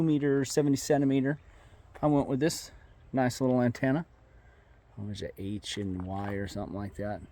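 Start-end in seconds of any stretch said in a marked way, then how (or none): none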